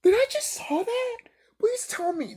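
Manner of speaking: high, breathless whisper; stunned whisper. Retold or imitated. Recalling his shock at the scene, whispered high. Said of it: "I'm like".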